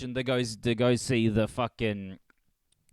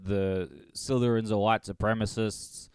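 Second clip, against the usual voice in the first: usual speaking voice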